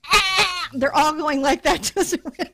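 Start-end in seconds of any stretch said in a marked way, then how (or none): 0.1-0.7 high loud mock bleat; hammed-up sheep yell; sheep scream imitation. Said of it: "And it's going".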